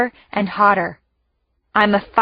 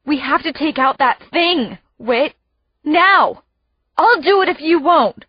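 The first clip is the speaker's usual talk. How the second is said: urgent; 6 semitones higher than usual. A narrator's reading. Urgent plea to brother.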